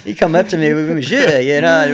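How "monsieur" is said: joking imitation of a French accent, loud and higher; badly faked French accent, played for laughs. Jockey mimicking his French trainer for radio hosts, badly faked French accent. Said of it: "with the French accent".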